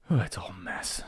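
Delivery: exasperated whisper. Real, not acted. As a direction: breathe it out, defeated. Exasperated aside about taxes.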